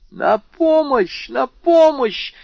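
very high, slow cries for help; scream for help. A narrator's reading. Young woman screaming for help at the intruder, high slow screams. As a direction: scream the house awake. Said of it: "закричала мадемуазель Дармюльи".

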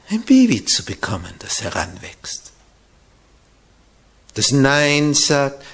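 ordinary delivery

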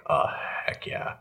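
ordinary talk, nothing marked

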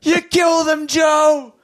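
high anguished wail, Joe!; hammed-up horrified cry; anguished shout. Mock anguish over father's stabbing.

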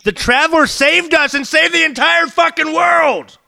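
high, slow furious yell; angry shout. Acted. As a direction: roar it in outrage. Acted quarrel in character.

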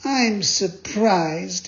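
slow, high and huffy; haughty puppet taking offence; offended. A narrator's reading. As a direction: draw it out, insulted. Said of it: "very much offended".

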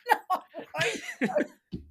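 giggling mock-desperate refusal; laughing protest. Retelling arguing with mum.

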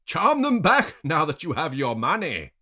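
high, loud goading jeer; goading shout. A narrator's reading. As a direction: egg him on loudly. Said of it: "the other man encourages me".

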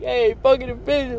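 high hammed-up crude catcall; mock crude catcall. Mocking lyrics he'd never write, high crude voice. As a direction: sneer it out, mocking. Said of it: "like, you know, like".

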